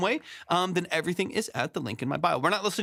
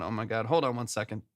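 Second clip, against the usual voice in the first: usual speaking voice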